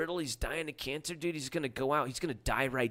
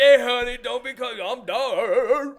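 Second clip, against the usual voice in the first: high, booming mock yell; shouted, over-the-top character voice; barking cook; 12 semitones higher, 14 dB louder than usual. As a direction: imitate a loudmouth heckling from the back. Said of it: "he was in the back barking and yelling at people".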